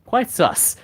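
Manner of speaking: mock British delivery of slang; British accent. Shown as a demonstration. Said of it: "Sus in a British accent".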